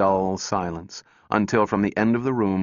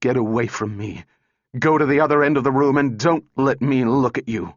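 louder, higher, furious order; mild shriek; 5 semitones higher, 4 dB louder than usual. A narrator's reading. Calvin orders the robot away, in a restrained shriek. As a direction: drive it away with a raised voice. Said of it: "shrieked at the robot".

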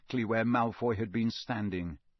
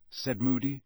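usual speaking voice

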